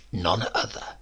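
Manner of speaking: low, breathy whisper with a grin; sly whisper. Acted. Uncle Bill confirming to his nephew who he is, in a sly whisper. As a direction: let it slip out as a secret.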